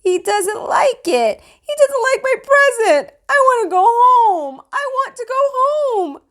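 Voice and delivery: put-on very high, loud wailing little boy; crying child. A narrator's reading. Sam upset his present was rejected, high tearful wail. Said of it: "Sam cried".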